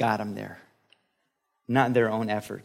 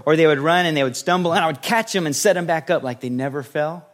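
ordinary talk, nothing marked

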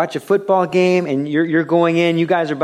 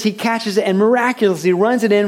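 high, loud game narration; rising sports excitement; excited play-by-play; 8 semitones higher, 4 dB louder than usual. Acted. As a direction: tell it like a live touchdown call.

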